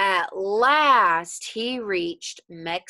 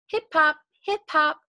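higher, louder hopping beats; bouncy hopping; 7 semitones higher, 4 dB louder than usual. A narrator's reading. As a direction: bounce each hip hop. Hopping rhythm narration.

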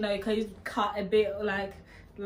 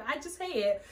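ordinary delivery

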